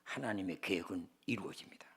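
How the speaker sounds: normal talking voice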